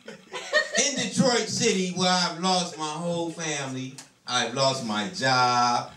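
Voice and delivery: ordinary talk, nothing marked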